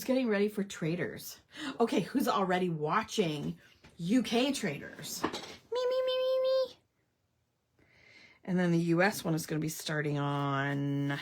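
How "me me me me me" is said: high eager me me me; excited squeal. Real, not acted. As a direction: squeal it like a fan. Eager self-answer to viewers.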